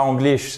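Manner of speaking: English begun with French nasal "an"; wrong English. Shown as a demonstration. Teacher repeating what to avoid, wrong French-style English. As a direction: mispronounce "English" as "Anglish". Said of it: "C'est pas English".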